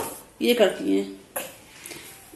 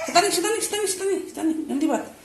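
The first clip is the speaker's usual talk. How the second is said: high sing-song mock scolding; 7 semitones higher, 4 dB quieter than usual. Real, not acted. Mother teasing her naughty baby in high baby talk.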